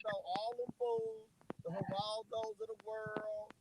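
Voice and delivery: distant, muffled voice on speakerphone; sounding far from the mic; far away. Real, not acted. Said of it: "Why you sound so far away?".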